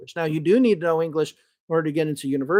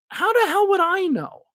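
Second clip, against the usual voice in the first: high-pitched incredulous rhetorical question; 12 semitones higher than usual. Real, not acted. Speaker admitting he can't judge Russian regional speech, incredulous. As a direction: throw up the absurdity, pitch jumping high. Acting out absurdity of outsider judging.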